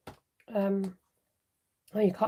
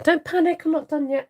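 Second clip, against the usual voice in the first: excited reassurance; 6 semitones higher, 6 dB louder than usual. Real, not acted. Reassuring viewers after a mishap.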